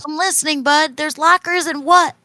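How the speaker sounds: high, eager questioning voice; [name]'s plant-speech voice. Acted. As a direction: urge a halting friend on. [name] prompts Bud in plant speech.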